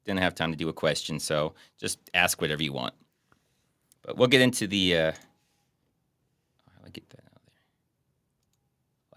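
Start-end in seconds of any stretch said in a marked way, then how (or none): 5.1-9.2 whisper. Real, not acted. Muttering aside to himself.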